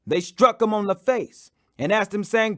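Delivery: usual speaking voice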